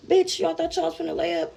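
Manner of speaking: high-pitched mocking challenge; retold taunt. Attacker to girl in bed, mocking taunt. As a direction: imitate an attacker catching someone out.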